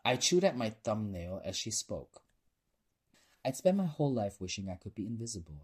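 plain reading voice

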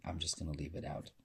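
ordinary delivery, nothing marked

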